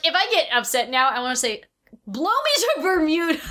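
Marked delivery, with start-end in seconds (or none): 2.1-3.4 high, exclamatory mock command; mock outburst. Friend shouting a silly movie curse when upset. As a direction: blurt it like a magic curse.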